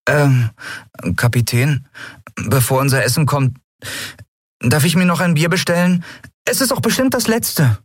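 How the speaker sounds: put-on high, hesitant boyish request; pleading youngster. A narrator's reading. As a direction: ask hopefully, pitch climbing to a plea. Young Miko begs for a beer.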